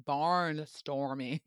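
"barnstorming" stretched out slowly; drawn-out repeat. Real, not acted. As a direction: puzzle over "barnstorming", dragging each part. Puzzles over unfamiliar word, repeated.

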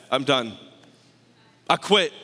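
low acted admission of defeat; giving up.

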